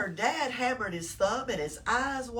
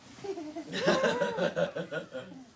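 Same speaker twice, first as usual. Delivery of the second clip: high laugh; 10 semitones higher than usual. Real, not acted. Laughing at reveal.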